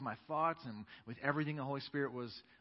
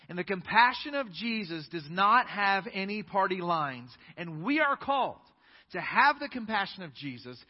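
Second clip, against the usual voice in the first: loud, higher preaching climax, slowing on key words; preaching at full force; 6 semitones higher, 9 dB louder than usual. Acted. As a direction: thunder it from the pulpit.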